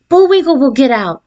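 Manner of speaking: ordinary delivery, nothing marked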